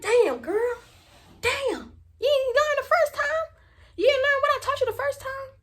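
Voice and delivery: high-pitched acted telling-off; exasperated, high retold reproach; exasperated scolding. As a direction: scold a friend in high disbelief. Acts out scolding Luna for screaming.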